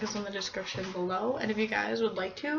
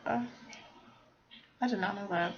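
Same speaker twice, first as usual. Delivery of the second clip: soft, very slow, mildly amazed; a quiet, drawn-out realisation; quiet slow surprise; 6 dB quieter, much slower than usual. Real, not acted. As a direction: murmur it slowly, half to yourself. Surprised aside reading the list.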